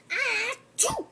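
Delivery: high, loud acted sneeze. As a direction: act out a big sneeze.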